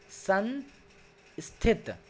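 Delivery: "संस्थित" drawn out very slowly; slow careful reading. Shown as a demonstration. Teacher reading the second word slowly. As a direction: stretch "संस्थित" for the students. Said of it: "एक एक शब्द को पढ़ रहा हूँ".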